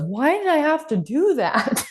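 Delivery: slow, high-pitched mock whine; whining kid. Boy back from running laps complaining, whiny. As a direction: imitate a child's drawn-out protest. Exhausted child protesting.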